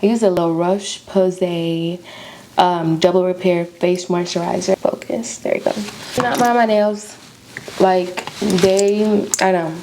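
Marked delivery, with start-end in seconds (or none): 4.8-5.7 whispering. Real, not acted. Whispering to self while applying makeup.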